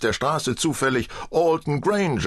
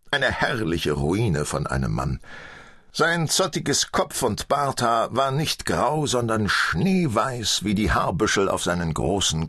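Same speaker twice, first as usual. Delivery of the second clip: ordinary delivery, nothing marked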